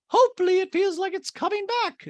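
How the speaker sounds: put-on high accented lady voice; Dorit impression. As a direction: play Dorit hopeful. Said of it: "Dorit goes".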